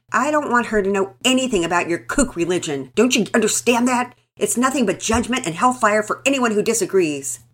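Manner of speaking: loud, seething contempt. A narrator's reading. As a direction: spit it out in fury. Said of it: "was positively seething".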